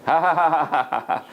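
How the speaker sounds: a hearty, slightly bitter laugh of resignation. Retold or imitated. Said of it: "Then he started laughing".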